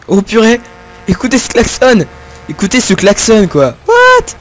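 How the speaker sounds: loud, high amazed cry; surprised shout. Real, not acted. Gamer reacting to a loud horn, surprised shout. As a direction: blurt it out in amazement.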